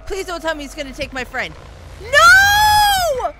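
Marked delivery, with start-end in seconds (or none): 2.0-3.3 high desperate scream. Real, not acted. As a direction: scream no with everything. Friend taken by monster.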